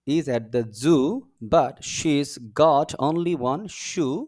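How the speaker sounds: very slow, word-by-word teacher reading; model reading aloud. Shown as a demonstration. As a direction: stretch each word for learners to follow. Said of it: "এটা একটু পড়ে দেখি".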